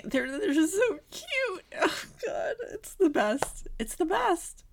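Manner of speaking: squealing high and breathy over cuteness; gushing. Real, not acted. Podcaster gushing about two girls on screen, squealing. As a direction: melt over how cute they are.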